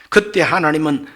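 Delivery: usual speaking voice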